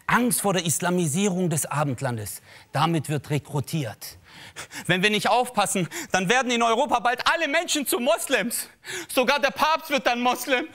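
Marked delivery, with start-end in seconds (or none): 4.8-10.7 high, urgent mock rabble-rouser; alarmist ranter. A comic mocks the fearmongers as a high alarmist ranter. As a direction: whip up the crowd like a populist.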